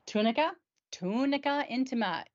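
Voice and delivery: light Italian accent, tunica intima. Real, not acted. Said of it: "Why do I want to say it with an Italian accent".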